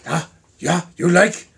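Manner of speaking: put-on slow, halting Japanese accent; mock Japanese accent. Playing the suspect flashing a passer-by, Japanese accent.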